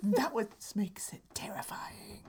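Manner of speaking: breathy spooky whisper. Acted. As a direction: hush it like a ghost story.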